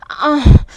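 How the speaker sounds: high, loud whimper; whimpered cry. A narrator's reading. Woman unable to answer Johnny, whimpered cry. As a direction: cry out helplessly. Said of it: "I whimpered again".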